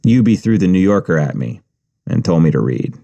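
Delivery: ordinary delivery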